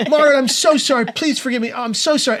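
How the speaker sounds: high, urgent pleading; frantic apology. Retold or imitated. Man to the date he just burned, frantic apology. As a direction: beg forgiveness in a high-pitched panic.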